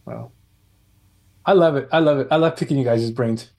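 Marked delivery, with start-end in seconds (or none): none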